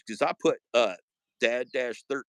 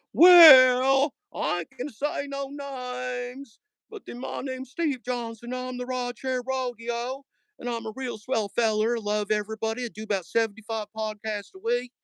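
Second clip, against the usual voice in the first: Steve Johnson impression; 10 semitones higher than usual. Mocking a rival podcaster, high folksy voice. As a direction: ham up a folksy podcaster bragging. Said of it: "that was my Steve Johnson impression".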